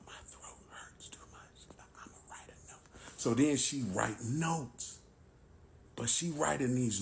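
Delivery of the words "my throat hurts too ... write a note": hushed, voiceless whisper. Retold or imitated. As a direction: imitate a wife whispering because her throat hurts. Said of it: "all this whispering".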